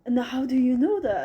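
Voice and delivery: retold freak-out. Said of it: "he got freaked out".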